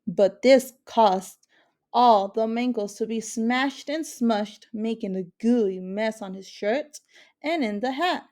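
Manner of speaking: ordinary delivery, nothing marked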